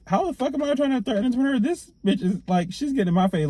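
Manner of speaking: high, fast, outraged disbelief; indignant retort. Retold or imitated. Retelling her own outraged reply to the lady. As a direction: pitch shoots up in a fast indignant rant. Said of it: "I'm like".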